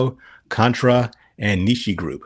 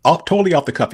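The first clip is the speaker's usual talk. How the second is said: ordinary delivery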